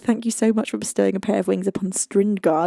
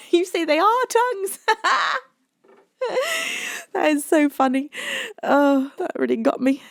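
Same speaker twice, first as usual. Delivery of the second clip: high, giggling through words; laughing; 10 semitones higher than usual. Real, not acted. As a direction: crack up at the joke. Laughing at misreading.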